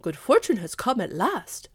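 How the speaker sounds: usual speaking voice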